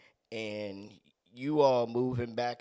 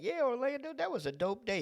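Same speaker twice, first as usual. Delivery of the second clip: mock self-talk; 13 semitones higher, faster than usual. Pretends to chat with himself.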